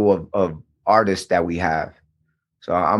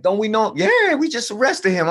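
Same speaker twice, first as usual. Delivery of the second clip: high-pitched imitation of two cops; acted cop recognizing a suspect; lightly voiced cops; 13 semitones higher than usual. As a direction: quote a cop, pitched well up. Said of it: "he calls over another cop".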